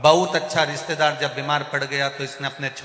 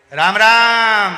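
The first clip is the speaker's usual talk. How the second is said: long drawn-out loud Ram Ram, higher; shouted greeting; 7 semitones higher, 14 dB louder, much slower than usual. Retold or imitated. Deaf grandpa greets the sick friend, slow bellow. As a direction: bellow the greeting slowly across the ward. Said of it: "कान से थोड़ा कमजोर होता है वो चिल्ला के बोलता है".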